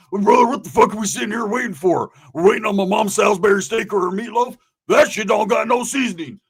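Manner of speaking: loud, high acted griping; mock-exasperated outburst; impatient rant. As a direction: rant as if itching to leave. Roach impatient to go.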